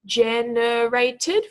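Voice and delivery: "generated" very slowly, syllable by syllable, rising at the end; stretched into syllables. Shown as a demonstration. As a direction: draw out each of the four syllables in "generated". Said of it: "stretch those out nice and slowly into individual syllables".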